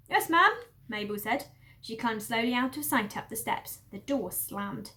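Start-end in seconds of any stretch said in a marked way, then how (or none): 0.1-0.5 high, loud servant voice. Acted. Farm woman answers her mistress, high and loud. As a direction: answer quickly, eager to please. Mabel voice.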